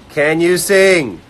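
loud, high, drawn-out shout; mock-shouted reading of a viewer's comment; typed loudly. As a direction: belt out a message as if typed in capitals. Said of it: "words typed loudly".

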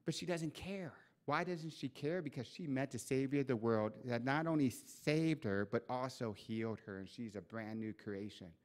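usual speaking voice

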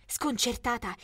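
plain reading voice

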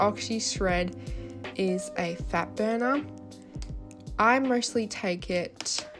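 usual speaking voice

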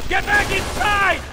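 shouted order. Acted. Lynch yelling at his partner to retreat inside, loud. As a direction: yell it over gunfire.

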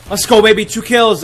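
loud, high cheer after a double kill; hype celebration. Real, not acted. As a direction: shout it out like you just won. Celebrating kills in game.

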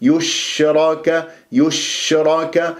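"يشرك" slowly, each vowel clean; slow recited "يشرك" with distinct short vowels; purified vowels. Shown as a demonstration. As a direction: keep the u off the sheen in "يشرك". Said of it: "حتى أصفي".

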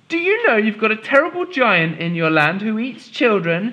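put-on high demanding girl; a bold little girl demanding. Molly demands.

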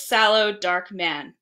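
ordinary delivery, nothing marked

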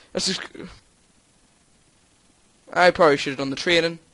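ordinary talk, nothing marked

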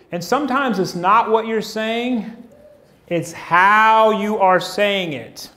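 slowed right down, leaning on "how"; deliberate sermon line, stretched out; slow emphasis. Shown as a demonstration. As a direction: draw it out slowly and stress "how". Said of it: "it's how we say it".

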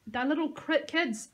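understated narrated scream. A narrator's reading. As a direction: call after him in desperation. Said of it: "I screamed after him".

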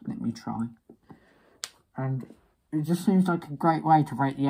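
muffled behind rubber mask; through a gas mask. Real, not acted. Seen 4: Full gas mask on face throughout; R4 holds mask near face.

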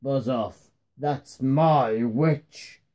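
low, slow monster voice; terrible beast. A narrator's reading. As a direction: warn off a rival like a monster. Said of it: "And its terrible voice".

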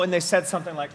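normal talking voice

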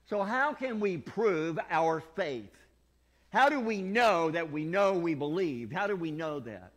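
loud, slow, high preaching question; slow, loud pulpit question. Real, not acted. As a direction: press the congregation hard. Preaching at full force.

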